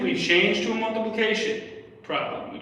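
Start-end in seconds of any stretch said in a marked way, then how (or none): 2.0-2.6 whispered under the breath; library whisper. Real, not acted. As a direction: keep it hushed so nobody hears. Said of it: "I am in a library right now".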